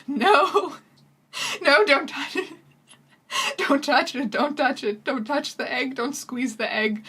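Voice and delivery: hammed-up fretting over the egg; mock panicking professor. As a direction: beg them not to touch it.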